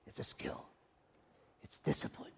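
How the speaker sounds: hushed whisper, intimate. Real, not acted. Preacher whispering to the church about discipline. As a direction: lean in and breathe it. Hushed intense sermon moment.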